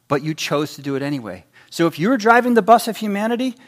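normal talking voice

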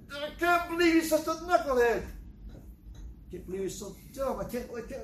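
high strained mock wail, fading to a whimper; hammed-up sobbing rant that falls apart; crying in frustration. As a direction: act out angry tears, choking on the words. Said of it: "You just, in frustration".

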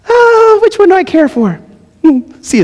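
hammed-up falsetto mocking favoritism; mock high falsetto. Preacher mocking a God who plays favorites, squeaky high falsetto.

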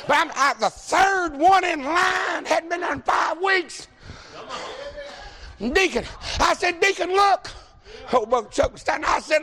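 loud, high shouted preaching, slowing down on key lines; roaring revival preacher driving it home; preaching at full force. Real, not acted. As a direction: build to a full-throated sermon climax. Preacher builds to full shouted exhortation.

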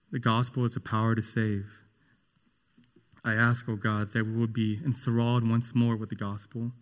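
measured, earnest pastoral prayer; steady, heartfelt closing prayer. Acted. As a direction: pray on behalf of the church.

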